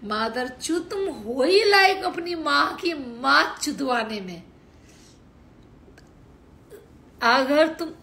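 imitating an abusive commenter at full volume; mock furious abuse. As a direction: hurl the insults like a furious troll. Acted abusive rant at trolls.

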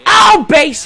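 high, loud, drawn-out cheer; excited shout. Real, not acted. Gamer cheering his base hit, excited shout. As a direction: shout it out, stretching the words.

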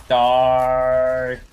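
loud snarled yell at an enemy; battle yell. Real, not acted. Gamer yelling at an enemy player, loud. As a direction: yell it as you attack.

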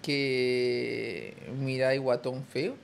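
very slow, word by word, low; drawn-out dictation for a learner. Shown as a demonstration. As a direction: spell it out slowly so she can repeat. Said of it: "Dile que diga".

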